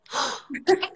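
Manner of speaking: a sudden startled gasp, rising; sharp gasp. Real, not acted. Actress startled by the squirrel, real gasp. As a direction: gasp as something catches you off guard.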